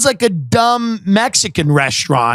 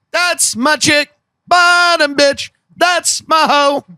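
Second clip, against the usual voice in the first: hyped singalong; 6 semitones higher, slower than usual. Retold or imitated. Singing along hyped.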